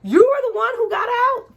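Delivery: high, loud mocking voice with a laugh; mock her line, laughing; mocking Kate. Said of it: "I didn't like her being like".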